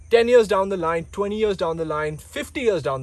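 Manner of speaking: loud, high urgent warning. Real, not acted. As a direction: hit it hard like a warning.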